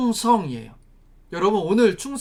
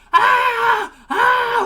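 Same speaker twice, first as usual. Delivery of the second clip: two high, loud acted yells; piercing wordless battle yell; 7 dB louder, much slower than usual. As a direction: scream out a war cry, twice. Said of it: "소리 지른 거밖에 없어요".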